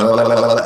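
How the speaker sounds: loud tongue-wagging lalala; rapid rolling nonsense trill; babbled la-la-la. Shown as a demonstration. Game master imitating his characters' verbal tic, loud babble. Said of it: "my characters actually go".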